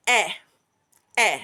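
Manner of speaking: a crisp short E, as in Egg; short e sound. Shown as a demonstration. Teacher modelling the short e for learners. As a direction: clip each E short and clear. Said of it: "The next sound".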